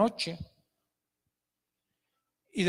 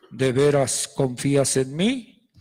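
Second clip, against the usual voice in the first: much lower, loud, slow searching question; voice of God; 9 semitones lower, 4 dB louder, slower than usual. Acted. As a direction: test his trust like God from above. God tests him.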